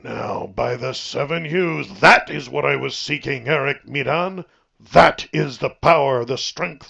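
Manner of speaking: booming, gleeful praise; savage delight. A narrator's reading. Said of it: "savage delight".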